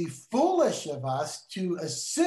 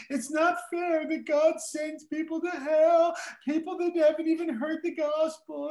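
high, tearful student pleading, much higher; upset student's high, pleading protest; 11 semitones higher than usual. Retold or imitated. Student in tears protesting to her teacher, high and pleading. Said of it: "she said, I'm not mocking her".

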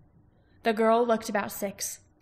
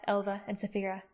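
ordinary delivery

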